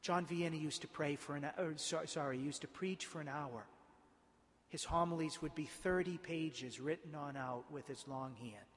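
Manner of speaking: usual speaking voice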